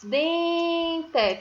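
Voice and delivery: "Dente" as a high, held "deeen" and a full "te"; two drawn-out beats on "Dente"; syllable by syllable. Shown as a demonstration. Teacher counting mouth openings for pupils, "Dente" in slow syllables. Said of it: "Quantas vezes eu abri minha boca?".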